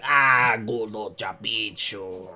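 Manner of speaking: alien-tongue grumble, dropping low and drawn out; slow, low alien speech; Rodian shopkeeper. Acted. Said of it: "turns to you".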